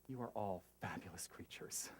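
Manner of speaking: breathless whisper; whispered blessing. Acted. Reading the play's blessing.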